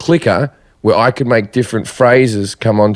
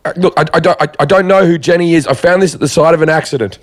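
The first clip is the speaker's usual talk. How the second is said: higher, stammering, hurried; flustered phone answer; 6 semitones higher, faster than usual. Retold or imitated. Comedian answering a front-row phone, high flustered stammer. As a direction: act a rattled stranger answering someone's phone.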